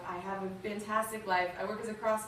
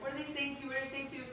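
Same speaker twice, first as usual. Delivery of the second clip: higher, anxious imitation of a crying mom; retold tearful worry; 5 semitones higher, 4 dB quieter, faster than usual. Mother freaking out on the phone to her daughter, retold tearful worry. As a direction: repeat it pleadingly, a mild imitation of tears. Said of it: "she started to cry".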